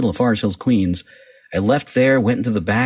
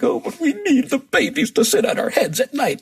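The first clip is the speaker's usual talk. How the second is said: put-on baby talk. Cooing over the cats.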